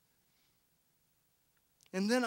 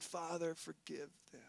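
much softer, lower, near-whispered prayer; hushed plea; 7 semitones lower, 18 dB quieter than usual. Retold or imitated. Jesus on the cross forgiving his killers, barely audible. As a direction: breathe it out like a dying plea. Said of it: "he said".

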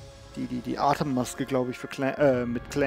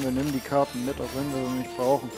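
ordinary talk

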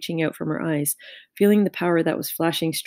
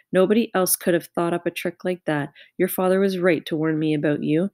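ordinary delivery

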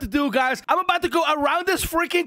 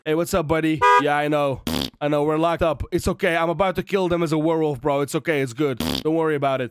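low, gentle reassurance to a caged buddy; soothing the pet; 9 semitones lower than usual. Real, not acted. Reassuring a locked-up wolf buddy, low and gentle. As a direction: talk low and calm like comforting a dog.

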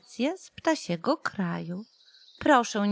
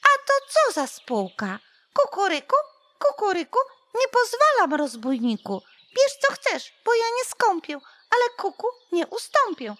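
shrieking cuckoo; 10 semitones higher than usual. A narrator's reading. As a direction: screech back at the rooster. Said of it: "wrzaśnie".

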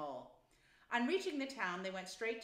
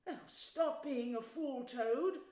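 slow, higher-pitched pleading; despairing cry; 5 semitones higher, 4 dB louder, slower than usual. A narrator's reading. Mole begs Toad to stop being a fool, despairing. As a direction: beg him in despair. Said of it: "cried the mole despairingly".

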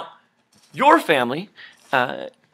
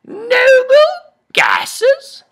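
snooty nasal voice; 7 dB louder, much slower than usual. Shown as a demonstration. Repeats the snooty noble voice.

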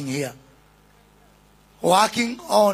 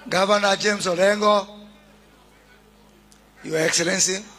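normal talking voice

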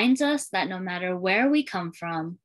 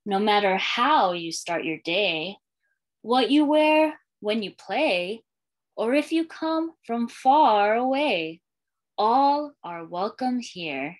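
put-on lilting read-aloud for young kids, refrain given room; storytime reading; slower than usual. Librarian reading a welcome book to schoolchildren, storytime lilt. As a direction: read it like a picture book to a class.

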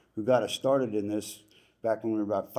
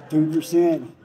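higher, louder rallying call; loud cheer; 6 semitones higher, 10 dB louder, slower than usual. Real, not acted. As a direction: shout it out to the crowd. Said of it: "Two percent, baby".

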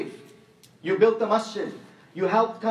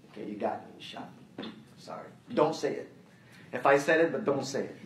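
hushed, mock-caught confession, half whispered; soft, rueful give-in to a child; sheepish, quiet admission; 7 semitones lower, 6 dB quieter than usual. Father admitting to his son he said it, quiet and sheepish.

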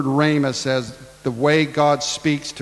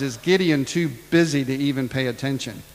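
ordinary delivery

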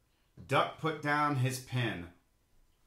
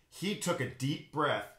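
plain reading voice

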